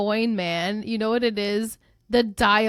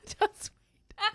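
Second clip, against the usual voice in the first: high teasing voice; 9 semitones higher, 6 dB quieter than usual. Acted. Teasing about what comes next.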